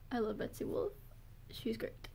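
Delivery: hushed fangirl whisper. Real, not acted. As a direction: drop to a secret whisper. Fan gushing about Betsy Wolf.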